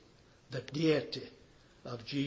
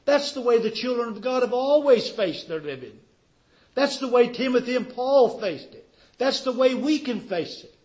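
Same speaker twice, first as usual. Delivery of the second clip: raised, emphatic preacher's voice; fervent preaching; 8 semitones higher, 9 dB louder than usual. Real, not acted. Preacher urging the congregation to face this trying day, fervent preaching.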